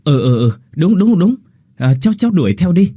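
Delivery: high, quick eager yes-yes; eager agreement. Acted. Mother eagerly urges Quyết to chase Linh. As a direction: jump in to agree fast. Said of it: "Bà Lan cũng hùa theo".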